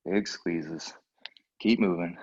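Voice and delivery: ordinary talk, nothing marked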